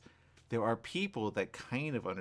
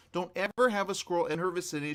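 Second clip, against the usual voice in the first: ordinary talk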